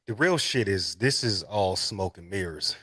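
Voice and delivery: ordinary talk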